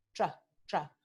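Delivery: tra with a blended tr; Chilean tr; affricated tr. Shown as a demonstration. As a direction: run t and r of tra together. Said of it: "se pronuncia tres".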